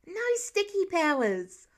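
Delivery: put-on high, loud, slow little girl's protest; objecting girl. A narrator's reading. Little sister objects to Peter's powers, high protest. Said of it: "Lila objected".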